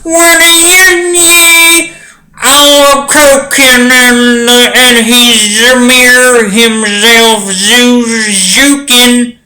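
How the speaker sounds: high, slow mock Bill Cosby voice; Bill Cosby impression. Reading a riddle answer aloud as a mock Bill Cosby. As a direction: drawl it out like a cartoon Cosby. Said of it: "I'm gonna read this like Bill Cosby".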